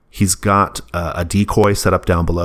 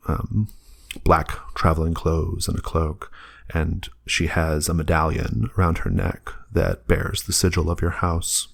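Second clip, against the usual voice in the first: hushed narration; 4 dB quieter than usual. Acted. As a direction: draw them in quietly. GM describes the floating figure.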